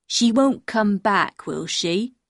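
put-on very slow, childlike question from a dim man; drawn-out, dim-witted questioning; simple-minded man. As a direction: ask slowly, as a simple fisherman. Simple man asks anxiously.

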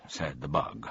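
ordinary delivery

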